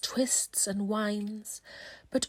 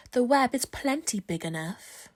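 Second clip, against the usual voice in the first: usual speaking voice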